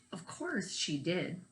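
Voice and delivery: normal talking voice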